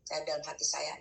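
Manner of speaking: usual speaking voice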